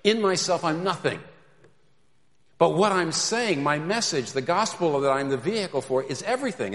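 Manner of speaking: loud, high, bold proclamation; humble and bold, ringing out; John the Baptist. Acted. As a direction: preach it as a fervent prophet. Preacher voices John the Baptist boldly.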